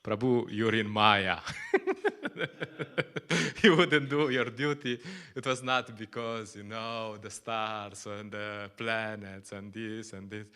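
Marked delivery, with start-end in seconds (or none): 0.0-5.0 put-on old-school scolding. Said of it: "they would speak like this".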